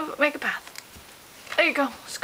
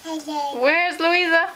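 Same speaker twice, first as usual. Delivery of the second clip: slow playful; much slower than usual. Pretend. Peekaboo game with baby.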